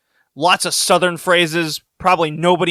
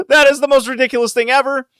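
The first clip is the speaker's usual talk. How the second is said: higher, laughing, fast; laughing disbelief; 10 semitones higher, faster than usual. Retold or imitated. Retelling his reaction to the chicken, laughing high. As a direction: crack up at how ridiculous it is.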